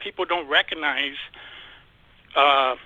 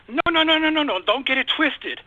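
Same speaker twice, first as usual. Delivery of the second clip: exasperated objection; 8 semitones higher than usual. Retold or imitated. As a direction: cut them off with high, insistent refusals. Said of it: "I get really, like, heartburn".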